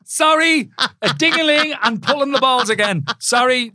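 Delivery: high, loud repeat of the innuendo; barely cope with the double meaning; amused disbelief. Real, not acted. Co-host cracking up at innuendo.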